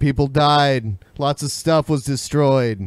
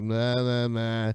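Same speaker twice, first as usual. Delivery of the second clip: reluctant mumble; 6 semitones lower, 8 dB quieter, slower than usual. Retold or imitated. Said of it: "and you just kinda like".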